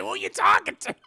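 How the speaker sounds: high, loud, laughing through it. Real, not acted. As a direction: say it cracking up. Repeating joke laughing.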